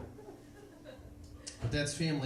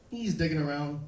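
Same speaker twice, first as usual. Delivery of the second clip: usual speaking voice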